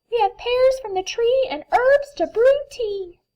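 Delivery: very high, squeaky small animals; little burrowers chirping; tiny critter voices. Acted. Birds speak.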